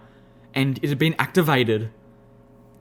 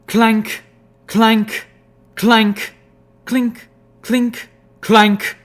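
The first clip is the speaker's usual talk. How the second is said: clanking noises; 8 semitones higher, 6 dB louder, much slower than usual. Acted. Sound effect words.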